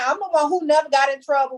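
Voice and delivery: normal talking voice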